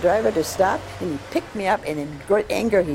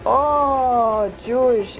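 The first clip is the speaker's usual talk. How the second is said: high, louder, drawn-out "oh" of joyful discovery; delighted recognition; 7 semitones higher, 4 dB louder, much slower than usual. Retold or imitated. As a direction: act out his happy surprise. Stranger's delighted recognition, then hug.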